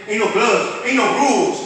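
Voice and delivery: normal talking voice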